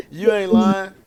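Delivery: loud, high burst of laughter into words; real loud laughing agreement. Real, not acted. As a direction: crack up, then agree through the laugh. Cracking up at the wordplay.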